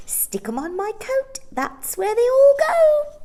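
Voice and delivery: very high, loud shrill instructing; high old woman. A narrator's reading. Old shopkeeper telling the children where coins go. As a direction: urge them on.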